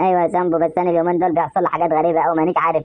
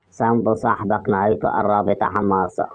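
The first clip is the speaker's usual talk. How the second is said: eerie mystery voice; 9 semitones lower, 3 dB quieter than usual. Acted. Possessed friend scaring Sambo with a doom warning, deep eerie voice. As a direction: intone it like a deep haunting spirit. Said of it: "ايه الصوت ده ومين اللي بيقول كده؟".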